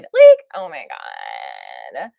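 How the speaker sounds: very low, slow, creaky "Like, oh my God"; vocal fry Valley Girl. Shown as a demonstration. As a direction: drop into a deep gravelly fry on "oh my God". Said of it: "I can do the vocal fry too".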